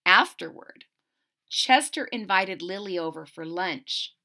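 plain reading voice

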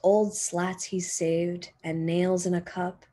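usual speaking voice

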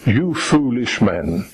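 ordinary delivery

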